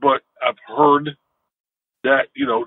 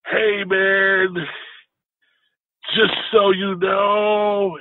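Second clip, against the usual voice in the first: higher, slow, laid-back California surfer voice; 8 semitones higher, much slower than usual. Retold or imitated. Big stoned son in the back seat greeting the driver, higher California surfer voice. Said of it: "almost California-ish surfer guy".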